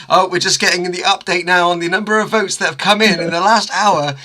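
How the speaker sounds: lightly hammed telethon update; telethon presenter. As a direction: play a live charity-show presenter. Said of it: "like Children in Need or something".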